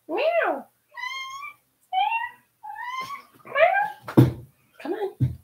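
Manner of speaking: very high meows, then a high coaxing call; cat meow. Pretend. Calling her cat down from the shelves with high meows.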